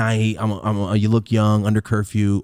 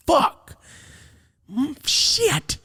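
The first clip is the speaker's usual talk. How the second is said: high dread; much slower than usual. Retold or imitated. Dad walks in, dread.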